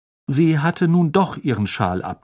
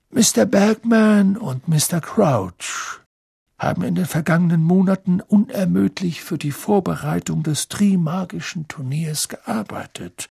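Dumbledore's address; 7 semitones higher than usual. A narrator's reading. Dumbledore introduces guests to the students, ceremonious. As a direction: announce grandly to the whole hall.

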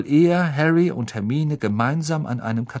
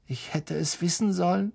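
higher, softer, tragic sigh; 7 semitones higher, 4 dB quieter than usual. Acted. Lavender lamenting her rabbit to Hermine, tragic. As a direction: play up the tragedy. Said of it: "sagte Lavender mit tragischer Miene".

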